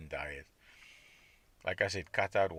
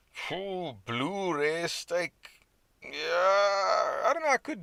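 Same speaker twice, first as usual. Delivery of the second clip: excited craving; 11 semitones higher, 6 dB louder, much slower than usual. Real, not acted. Excited about raw steak.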